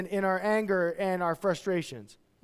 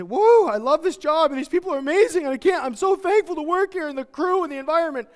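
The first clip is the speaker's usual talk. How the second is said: high, loud gushing delight; mock delight; 7 semitones higher, 5 dB louder than usual. Employee celebrating a raise, hammed-up gushing joy. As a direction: gush like you just got a raise.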